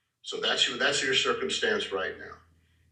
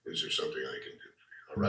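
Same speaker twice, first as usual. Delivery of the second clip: softer, half-whispered, unbothered; barely looking up from the watch; cold quiet brush-off; 10 semitones lower, 7 dB quieter than usual. Shown as a demonstration. Clerk barely looking up at the waiting customer, quiet and cold. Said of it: "until they look up and say".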